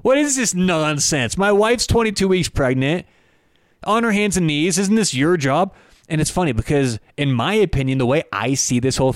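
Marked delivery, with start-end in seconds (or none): 0.0-5.7 higher, whiny mock complaint at the airline; mocking indignant athlete. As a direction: parody the entitled dad griping, pitched up. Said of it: "he's saying".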